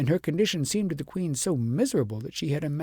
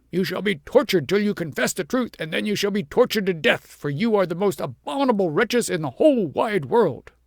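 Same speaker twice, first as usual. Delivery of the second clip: higher, louder, menacing; furious threat; 6 semitones higher, 5 dB louder than usual. A narrator's reading. King threatens the shepherds with torture, loud and high. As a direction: threaten with fury.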